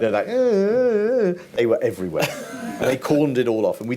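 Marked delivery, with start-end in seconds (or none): 0.3-1.3 high mock siren wail imitated by voice; French police siren. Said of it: "those lovely French police sounds".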